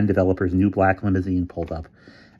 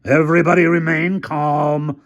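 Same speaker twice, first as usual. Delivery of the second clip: high, louder shriek over a megaphone; terrified shriek; 9 semitones higher, 6 dB louder than usual. A narrator's reading. Hamilton shrieking into the megaphone, terrified. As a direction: fake calm while terrified. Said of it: "shrieked Hamilton's terrified voice".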